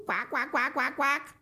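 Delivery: rapid string of high quacks; imitated duck chattering down the phone; duck quacking. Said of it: "said a voice at the other end".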